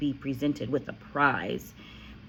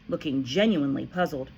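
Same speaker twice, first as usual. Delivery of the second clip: usual speaking voice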